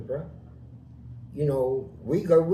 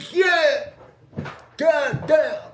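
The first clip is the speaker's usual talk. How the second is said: high, loud retold hollering; imitated scream; 11 semitones higher, 6 dB louder, much slower than usual. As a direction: holler out like the man in the story. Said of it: "he screamed".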